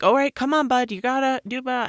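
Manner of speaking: put-on rally the scared boy firmly; brisk coaxing. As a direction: nurses loudly urging a frightened boy to hold still. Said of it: "they're like".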